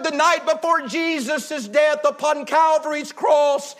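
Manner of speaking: normal talking voice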